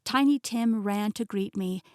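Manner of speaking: usual speaking voice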